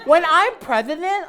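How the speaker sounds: put-on high loud kid voice, stretched; hammed-up child's boast; whiny kid. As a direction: declare it like a whiny boy. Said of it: "I can imagine Andrew now as a kid".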